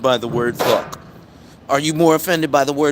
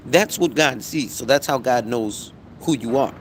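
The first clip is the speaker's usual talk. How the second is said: ordinary talk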